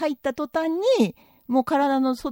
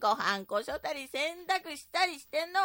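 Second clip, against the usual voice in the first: indignant wife in a skit. Acted. Wife tells husband who does all housework, indignant. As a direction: give your husband an indignant telling-off. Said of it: "でけえ声出して".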